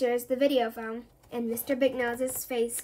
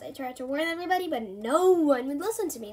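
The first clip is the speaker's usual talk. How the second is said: plain reading voice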